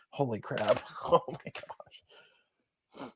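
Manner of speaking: pained, breathless gasp of "Holy crap", "Oh my gosh"; back spasm. Real, not acted. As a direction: wince through sudden back pain. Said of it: "I'm having a back spasm".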